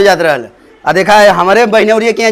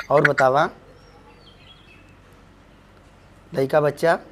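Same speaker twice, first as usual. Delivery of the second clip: low, soft, cosy murmur; private phone chat; 5 semitones lower, 6 dB quieter than usual. Acted. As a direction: keep it low, chatting tenderly on the phone. Husband chats privately on phone. (फोन पर धीरे-धीरे मीठ बतकही)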